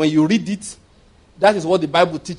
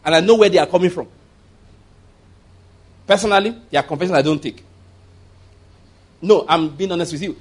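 ordinary talk

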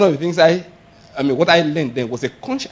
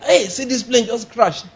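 higher, drawn-out cry of alarm; acted alarm; 6 semitones higher than usual. Preacher acts out someone seeing a plane crash, alarmed. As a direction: announce the disaster, acting startled. Acting a reaction to bad news.

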